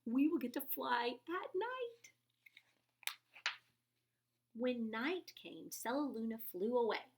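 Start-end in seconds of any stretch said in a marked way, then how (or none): 0.0-2.1 excitedly. A narrator's reading. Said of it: "Stellaluna said excitedly".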